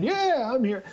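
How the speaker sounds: very high eager shout; excited yell. Retold or imitated. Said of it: "You know, I'm screaming".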